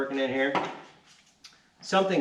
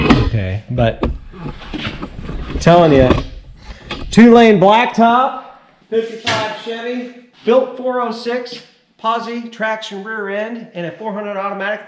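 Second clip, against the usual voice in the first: excited; 6 semitones higher, 8 dB louder than usual. Real, not acted. Hyping the fifty-five Chevy.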